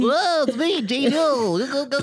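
very high squeaky voice, bouncing; high-pitched goofy impression. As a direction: pipe it up like an excited kid. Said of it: "your impersonation of me".

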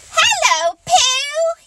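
high, excited little squeak; squeaky. A narrator's reading. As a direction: squeak it like a tiny eager joey. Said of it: "he squeaked".

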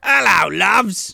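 playful Cockney hello; Cockney Michael Myers. Acted. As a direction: greet them in a put-on Cockney accent. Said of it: "Michael Myers is just Cockney".

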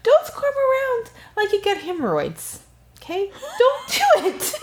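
high-pitched exasperated telling-off; playful high nagging; mock scolding. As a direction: scold them in a comically high voice. Scolding fidgeters on video calls.

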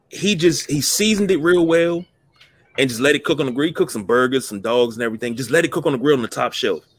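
ordinary talk, nothing marked

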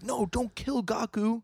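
high, drawn-out mock wail; mock panicked plea. Host voices a boss begging for Gaku, mock panicked plea. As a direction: plead in an exaggerated high voice.

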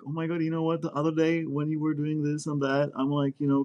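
mocking an overly gentle way of giving criticism; mock gushy. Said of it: "Should I be like".

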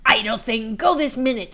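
loud, imperious snapping; angry scolding. Acted. Helen orders Marouckla out at once, angry scolding. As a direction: scold her as a lazy servant.

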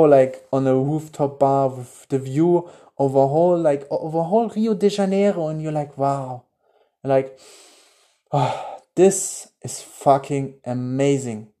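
hushed guided daydream; guided visualization. Acted.

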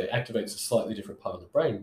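usual speaking voice

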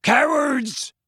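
high, loud furious bellow; Hagrid bellowing. A narrator's reading. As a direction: bellow it across the grounds. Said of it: "bellowed Hagrid".

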